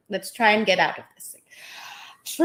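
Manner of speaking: ordinary talk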